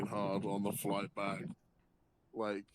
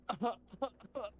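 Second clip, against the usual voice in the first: high, stuttering mock laugh like a sputtering engine; imitated diesel-generator laugh; 10 semitones higher than usual. Friend imitating the weasel's laugh on the plane, sputtering mock laugh. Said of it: "he sounds like a fucking diesel generator".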